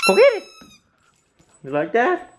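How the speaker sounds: squealed command to the dog; excited urging. Real, not acted. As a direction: egg the dog on.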